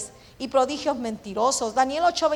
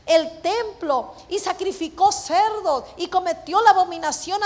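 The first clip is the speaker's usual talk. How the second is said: high, forceful pulpit delivery; impassioned preaching; 6 semitones higher, 5 dB louder than usual. Real, not acted. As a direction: preach it in raised, driving waves. Preaches the temple's desecration at full pitch.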